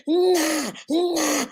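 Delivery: baby crying impression, in short bursts; imitated baby wail. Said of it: "If that girl scream".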